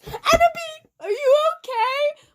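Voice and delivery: high, loud, tearful concern. Acted. Bloody Mary asks the crying singer, high and concerned. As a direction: ask it like a worried friend about to cry. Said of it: "asked in a concerted voice".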